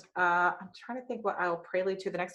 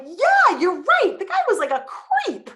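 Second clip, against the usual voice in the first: high, louder, eager agreement; mock eager agreement; 12 semitones higher, 8 dB louder, slower than usual. Teen suddenly siding with dad, high and chirpy. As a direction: flip sides, chirpy and eager. Mocking her flipped attitude.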